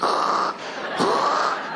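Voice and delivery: harsh voiceless scrape; imitated scraping noise. Scraping empty deodorant on an arm, imitated harsh scrape. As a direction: rasp out a long scraping sound.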